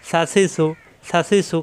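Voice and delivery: সা সি সু run together in short syllables; no lingering. Shown as a demonstration. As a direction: say সা সি সু without dragging any vowel. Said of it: "কোনো দেরি করা যাবে না". (দেরি না করে সা সি সু)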